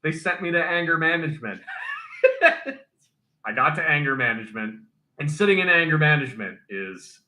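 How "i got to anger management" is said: laughing. Real, not acted. The armorer laughs telling the hosts he was sent to anger management, laughing. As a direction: laugh it out, then talk through the giggle.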